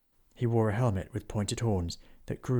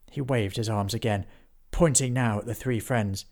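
ordinary delivery, nothing marked

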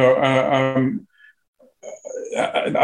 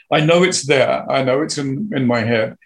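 ordinary delivery

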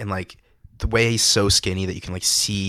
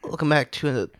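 mock reviewer intro; 7 semitones higher than usual. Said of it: "What is the intro?".

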